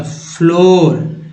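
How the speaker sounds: फ्लोर drawn out slowly with a long ओ; slow clear फ्लोर; slow demo word. Shown as a demonstration. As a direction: stretch फ्लोर, hold the ओ. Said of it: "ओ हो गया".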